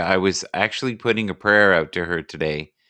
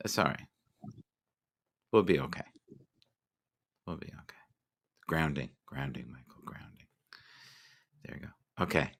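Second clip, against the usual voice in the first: low, fading almost to a whisper; calm yourself down, softer with each phrase; self-soothing murmur; 6 semitones lower, 14 dB quieter than usual. Pretend. Host talks himself into calm, hushed self-soothing.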